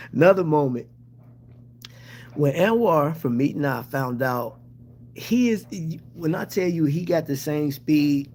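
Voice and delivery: ordinary talk, nothing marked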